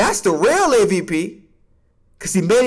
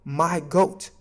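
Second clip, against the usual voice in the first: ordinary talk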